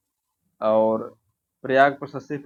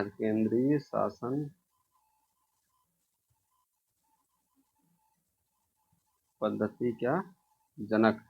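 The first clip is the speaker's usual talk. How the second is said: केंद्रीय शासन पद्धति का जनक spoken very slowly and softly with pauses; teacher spelling out the term for notes; slow dictation; 6 dB quieter, much slower than usual. Shown as a demonstration. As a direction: dictate the phrase word by word.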